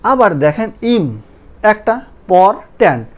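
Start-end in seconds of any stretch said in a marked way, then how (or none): 0.9-1.1 ইম set apart as one syllable; syllable split. Shown as a demonstration. Teacher counting syllables, ইম said as a separate beat. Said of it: "তিনটে syllable হয়ে গেছে". 2.3-3.1 পর ট্যান্ট as two separate beats; syllable split. Shown as a demonstration. Teacher counting three syllables, পর ট্যান্ট split apart. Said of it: "তিনটে syllable হয়ে গেছে".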